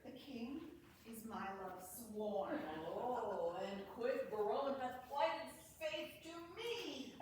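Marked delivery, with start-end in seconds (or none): none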